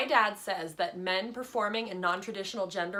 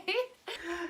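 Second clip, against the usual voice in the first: chuckling; 5 semitones higher than usual. Acted. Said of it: "Kelly was chuckling too".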